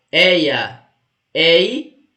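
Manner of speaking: "Eia, ei" isolated, with an open é; open diphthong. Shown as a demonstration. Teacher isolating the open diphthong for students, "Eia, ei" said open. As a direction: sound out "Eia, ei" as separate open éia, éi. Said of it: "é um ditongo aberto aqui".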